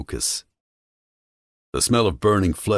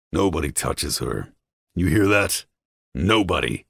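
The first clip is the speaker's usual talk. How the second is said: bellow. A narrator's reading. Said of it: "I bellow".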